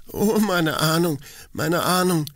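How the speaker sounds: put-on high young woman's anguished cry; Mina crying out. A narrator's reading. Mina cries out to her mother in anguish. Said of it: "rief Mina aus".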